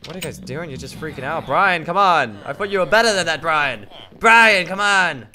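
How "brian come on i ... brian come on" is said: loud exasperated calling. Real, not acted. Scolding friend Brian, loud exasperation. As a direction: call him out in frustration.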